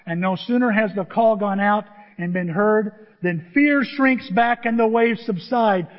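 ordinary delivery

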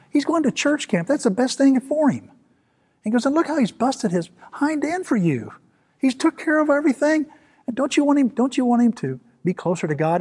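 high-pitched, urgent pleading; retold scolding in a high, emphatic voice; insistent grandmother. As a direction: argue his case, voice pitched well up. Grandmother argues the boy's case to dad.

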